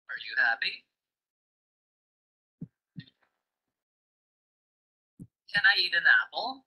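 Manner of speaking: yes-no questions climbing at "happy", "apple"; rising question intonation. Shown as a demonstration. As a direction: lift the pitch on "happy" and "apple". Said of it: "The sound rises for yes-no questions".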